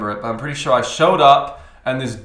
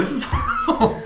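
high squealing laugh-talk. Real, not acted. Laughing reaction.

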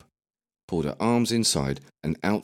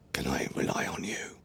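hushed secret whisper; 6 dB quieter than usual. Acted. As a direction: breathe it conspiratorially. Secret request, leaning close.

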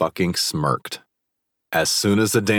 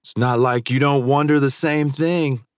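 smirking taunt; 9 semitones higher than usual. A narrator's reading. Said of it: "Carter smirked back at me".

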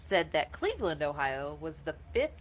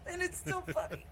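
laughing; 12 semitones higher, 8 dB quieter than usual. Real, not acted. Still laughing at the joke.

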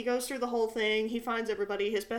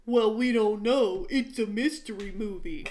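mock know-it-all; 5 dB louder than usual. Some guy answering a girl in the theater, mock condescending.

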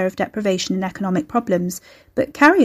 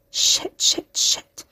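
frozen, voiceless cursing; whispered panic; much slower than usual. A narrator's reading. Carrie frozen as a dreaded caller speaks, whispered panic. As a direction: swear under the breath, all whisper.

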